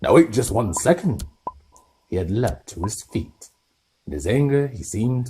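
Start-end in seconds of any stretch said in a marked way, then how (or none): 0.0-1.2 loud, firm halt; angry giant. Acted. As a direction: stop them in their tracks. Hagrid angry.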